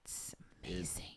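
whisper. Pretend. Hushed hype about their song.

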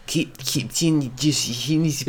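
Cape Town in a mock South African accent, slowed. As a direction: stretch the vowels like a jokey South African. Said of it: "is that a South African accent".

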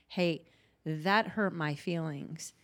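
ordinary talk

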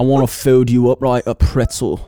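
loud hammed-up British lad; rough British accent, played for laughs; mock British accent. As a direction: ham up a cheeky London bloke. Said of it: "British character voice".

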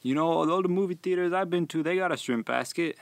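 high, pushy disbelieving customer voice; indignant customer. Retold or imitated. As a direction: play the baffled customer who expects shrimp. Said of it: "And he was like".

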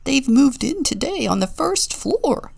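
excited pigeon delivering gossip; gleeful burbling. A narrator's reading. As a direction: burble the news with delight. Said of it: "he burbled with glee".

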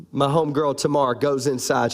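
normal talking voice

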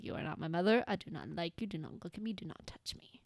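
hushed, standoffish dog warning people off; quietly possessive dog, sinking toward a whisper; antisocial dog impression. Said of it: "my impression of him is".

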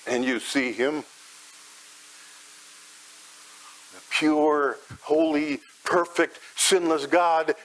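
tender sermon visualization. Acted. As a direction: lead the listener gently into peace. Gentle guided imagery of grace.

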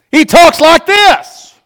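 imitating a kid who bellows it out; high, very loud, drawn-out holler; loud imitation. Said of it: "When I need him to be quiet".

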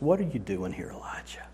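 low, very soft whisper; a still small voice, nearly breath alone; whispered voice of God. Retold or imitated. God to Elijah hiding in a cave, whispering. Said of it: "God comes to him and he says".

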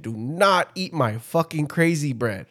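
"do not" slow and deliberate, NOT leaned on; emphatic repeat. Retold or imitated. Repeating the bread warning, "not" stressed slowly. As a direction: hit "not", then spell out the warning slowly.

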